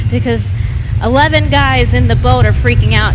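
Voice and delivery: ordinary delivery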